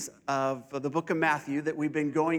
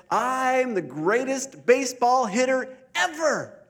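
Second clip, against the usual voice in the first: put-on boastful boy; 6 semitones higher, 4 dB louder, slower than usual.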